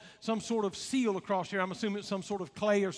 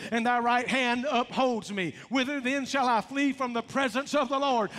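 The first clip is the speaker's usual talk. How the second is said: ordinary talk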